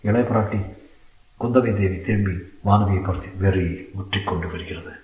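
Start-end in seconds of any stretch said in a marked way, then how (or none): 3.4-4.9 lower, softer aside. A narrator's reading. Kundavai turns to Vanathi with a soft, low aside. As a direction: say it under the breath to a friend. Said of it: "மெல்லிய குரலில் கூறினாள்".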